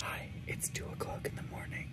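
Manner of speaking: soft late-night whisper. Real, not acted. Alone in bed at night, whispering to camera. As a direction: breathe it out so nobody wakes. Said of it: "I don't know why I'm whispering".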